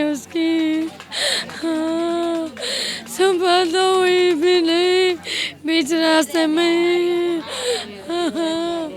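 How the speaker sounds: slow, drawn-out crying lament; real grief-stricken wailing. Real, not acted. Mother mourning her boy who never saw morning, wailing in real grief. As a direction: wail each phrase out long, breaking into sobs. Bereaved woman breaks down mourning.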